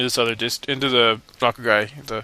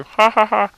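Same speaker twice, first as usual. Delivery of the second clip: loud, higher goofy laugh; silly laugh; 9 semitones higher, 5 dB louder, slower than usual. Real, not acted. Laughing at the swordsman in the game, loud goofy ha ha. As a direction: belt out a goofy ha ha. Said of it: "I like my laughter too".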